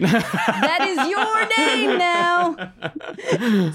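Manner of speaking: high, very drawn-out pronouncement; hammed-up overruling, every word stretched; mock firm decree. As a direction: stretch it out high, laughing, brooking no argument. Band overrules Norman's objection to name.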